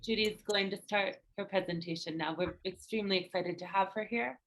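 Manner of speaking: normal talking voice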